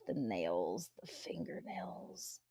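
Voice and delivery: breathy disgusted whisper. Real, not acted. Streamer hushes while describing the smell, whispered.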